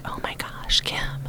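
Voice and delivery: whispered, awed recognition; hushed gasp. Retold or imitated. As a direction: breathe it out in a stunned hush. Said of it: "she was like".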